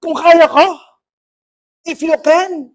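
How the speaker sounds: high, forceful affronted retort; offended Lord. Retold or imitated. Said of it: "Parang na-offend si Lord eh". (parang na-offend, mataas at madiin)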